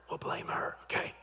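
conspiratorial whisper; whispered aside. Real, not acted. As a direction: drop to a whisper for the joke. Joking aside to audience.

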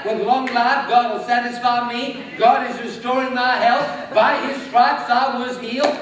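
loud, strong confession of health; bold declaration. Shown as a demonstration. Preacher showing the congregation how to declare health, loud. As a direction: declare it like you mean it. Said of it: "start declaring".

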